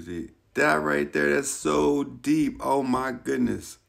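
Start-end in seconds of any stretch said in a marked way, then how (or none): none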